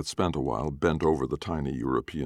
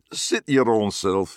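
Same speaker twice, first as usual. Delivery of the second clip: slow, loud Glaswegian; thick Glaswegian dad; Glaswegian accent; 6 semitones higher, 5 dB louder, slower than usual. Acted. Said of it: "thick Glaswegian accents".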